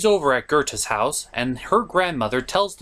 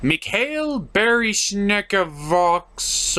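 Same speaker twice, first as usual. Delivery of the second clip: slow struggling read; much slower than usual. Real, not acted. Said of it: "what is this word?".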